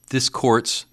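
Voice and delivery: a lightly voiced anxious Scrooge; nervous old miser asking the spirit. A narrator's reading.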